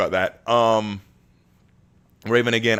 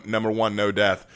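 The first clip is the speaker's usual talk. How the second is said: ordinary talk, nothing marked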